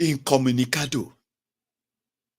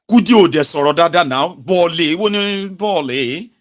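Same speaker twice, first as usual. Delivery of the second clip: mocking; 5 semitones higher, 7 dB louder than usual. Mocking the accent loudly.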